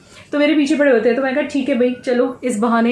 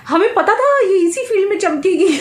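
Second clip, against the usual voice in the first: papa's proud quip; 9 semitones higher than usual. Retold or imitated. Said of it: "तो पीछे से बोलते हैं".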